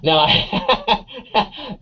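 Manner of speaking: words through a light laugh. Real, not acted. Guest reacting to the host's Veep pick, laughing. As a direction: laugh while starting to answer.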